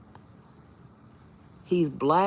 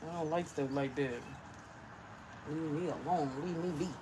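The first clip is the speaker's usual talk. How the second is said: soft scared plea; 11 dB quieter than usual. Real, not acted. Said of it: "Your girl is scared".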